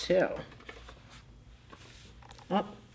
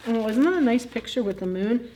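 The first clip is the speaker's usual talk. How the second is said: ordinary delivery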